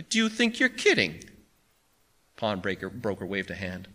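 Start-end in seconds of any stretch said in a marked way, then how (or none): 0.0-1.2 high-pitched scornful rhetorical question. A narrator's reading. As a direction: challenge him in disbelief. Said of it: "said Barney rhetorically".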